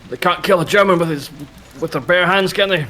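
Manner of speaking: put-on high, stiff old soldier; a proud veteran sizing up a recruit; old army man voice. Mr Muntz voice.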